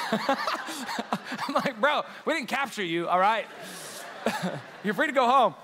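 cracking up while reassuring him; laughing. Real, not acted. Pastor laughing as he tells J R he may leave, laughing. Laughing at J R's sleepy question.

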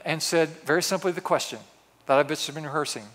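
ordinary delivery

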